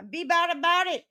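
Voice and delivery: loud high insistence; loud riled-up Chicago energy; emphatic loud. Real, not acted. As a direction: punch every word, riled up. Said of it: "I mean, you know what I mean".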